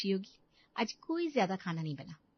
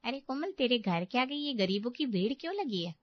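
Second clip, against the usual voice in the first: normal talking voice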